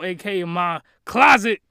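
usual speaking voice

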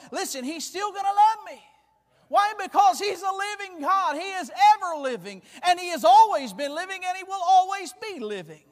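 high, driving sermon crescendo; full-force preaching. Real, not acted. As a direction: proclaim it with rising conviction.

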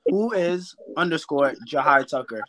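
slow, careful handle readout. Real, not acted. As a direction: say the handle slowly so people get it. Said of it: "say that slower one more time".

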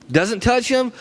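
ordinary talk, nothing marked

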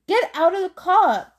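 mother ordering her son out urgently; deadly serious. Acted. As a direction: command him out of the car, dead serious. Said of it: "deadly seriously".

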